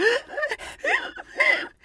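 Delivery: high, shaky sob of relief; tearful sobbing gasp. Retold or imitated. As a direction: mimic her crying gasp. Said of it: "she's crying".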